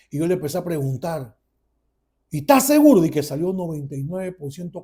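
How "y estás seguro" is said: high, loud disbelieving question; incredulous question. Retold or imitated. Son questions father on the DNA result, incredulous. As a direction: challenge it in disbelief.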